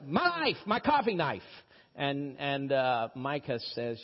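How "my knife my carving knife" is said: much higher, insisting on his knife; pitched-up mock command; mock authority. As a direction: play the man of the house, asserting it. Acting the man of the house.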